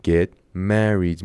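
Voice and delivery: "Get married" drawn out very slowly; slow model take. Shown as a demonstration. Teacher repeats phrase slowly for students.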